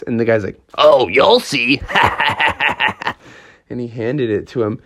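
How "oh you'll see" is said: slow hint ending in a villain laugh; sinister teasing. Acted. Game runner hinting to Froggy at trouble, ominous laughing tease. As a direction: draw it out, then cackle knowingly.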